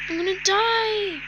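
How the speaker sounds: loud, high panicked cry. Real, not acted. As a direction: yell it like the end has come. Panic during the disaster.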